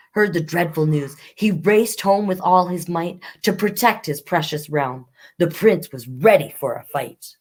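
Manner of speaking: lively rhyming read-aloud; reading a rhyming fairy tale to children; storybook narration. Acted. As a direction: tell the fairy tale to young listeners.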